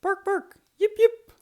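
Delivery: high yapping bark and yip; yappy dog barks. Acted. Roy barks and yips from inside his house, yappy. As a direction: yap like a small dog.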